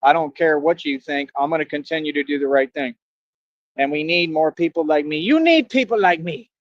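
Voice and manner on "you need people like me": higher-pitched, theatrical bad-guy delivery; movie bad-guy voice. Vlogger quoting a movie bad guy to viewers, theatrical villain voice. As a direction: put on a defiant film-villain voice.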